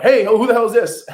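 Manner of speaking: loud, high mock snarl at a stranger; hammed-up irritated pickup; annoyed plumber. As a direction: imitate a grumpy plumber barking down the phone. Gruff plumber answering a cold call.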